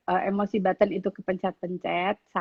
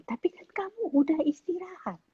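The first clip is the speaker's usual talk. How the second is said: high mock-indignant mother; playful imitation of a protesting mum; retorting mum; 10 semitones higher, 4 dB quieter than usual. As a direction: push back at the kid, pitched well up. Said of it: "Terus saya bilang".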